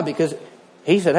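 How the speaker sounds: normal talking voice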